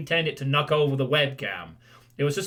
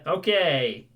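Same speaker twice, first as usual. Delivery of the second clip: usual speaking voice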